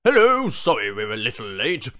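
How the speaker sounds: loud, brisk corporate man; breezy businessman apologizing. Acted. Businessman barges in.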